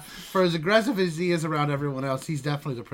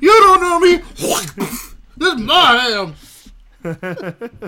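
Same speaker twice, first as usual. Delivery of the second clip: high, loud furious yell; angry shout; 10 semitones higher, 15 dB louder, slower than usual. Retold or imitated. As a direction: scream it like a raging drunk. Acting out the arrested man.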